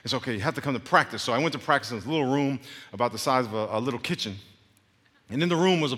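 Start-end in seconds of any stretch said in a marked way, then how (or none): none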